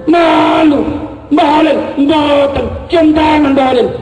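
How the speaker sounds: high, shouted delivery; emphatic outburst. Acted. Preacher tells santri how kiai reacted, emphatic high shout. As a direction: drive the point home to the crowd. Answers own question emphatically.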